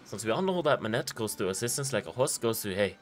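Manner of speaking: hammed-up French accent reading a letter; stupid French accent. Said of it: "do my stupid French accent sometimes".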